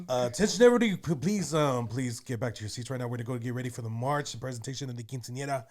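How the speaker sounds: loud party emcee calling the guests; quinceañera MC. Shown as a demonstration. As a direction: announce it like a quince DJ. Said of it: "I'm saying that because I used to be a M C".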